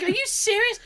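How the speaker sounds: surprised aunt. Retold or imitated. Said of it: "She's like".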